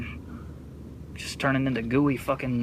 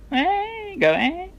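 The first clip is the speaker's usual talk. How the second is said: high-pitched call; 4 dB louder, much slower than usual. Real, not acted.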